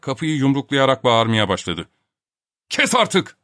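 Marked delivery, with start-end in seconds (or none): 2.7-3.4 high-pitched angry command; roaring. A narrator's reading. Professor to Topak banging on the locked door, roaring. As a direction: cut off the racket sharply. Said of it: "diye gürledi Filip Filipoviç".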